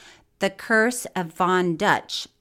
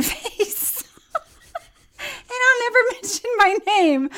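laughing; 14 semitones higher than usual. Real, not acted.

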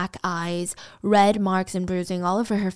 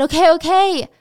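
desperate surrender, higher and louder; giving in; 8 semitones higher, 9 dB louder than usual. Retold or imitated. Beaten woman giving in to attacker, desperate. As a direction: yield in panic. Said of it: "Sandy finally said".

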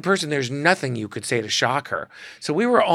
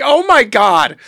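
much higher, louder exasperated groan; exasperated Melissa; 10 semitones higher, 12 dB louder, slower than usual. Retold or imitated. Melissa reacting to the pair's antics, exasperated outburst. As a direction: roll your eyes out loud. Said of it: "And Melissa's like".

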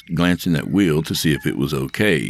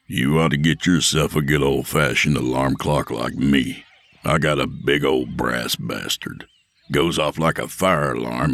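lower, hard-edged bully lecturing; Will's tough voice; 3 semitones lower than usual. Acted. Will voiced by narrator.

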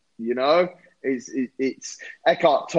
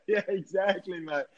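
laughing; 5 semitones higher, 5 dB quieter than usual. Real, not acted. Laughing at the host's joke.

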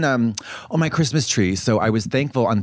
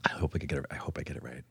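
much softer, lower, half to herself; low hushed lead-in; soft murmured start; 8 semitones lower, 16 dB quieter, much faster than usual. Shown as a demonstration. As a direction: ease into the recitation quietly. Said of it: "where I say".

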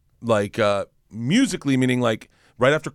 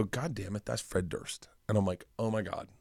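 very soft, low aside; muttered to himself; 3 semitones lower, 13 dB quieter than usual. Retold or imitated. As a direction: mutter it under your breath. Muttering to himself on spotting celebrity.

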